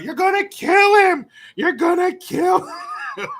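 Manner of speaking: high, loud, slow shriek of alarm; mock panicked scream. Substitute teacher running out at the fight, mock panicked shriek.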